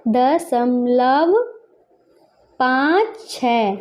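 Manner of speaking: very drawn-out words, spaced apart; slow dictation. Shown as a demonstration. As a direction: stretch each word while writing. Dictating while writing in words.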